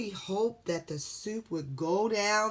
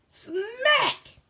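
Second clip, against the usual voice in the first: smack sound; 8 dB louder than usual. Acted. Door slam effect.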